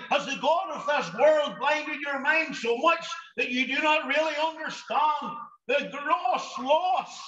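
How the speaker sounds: ordinary talk, nothing marked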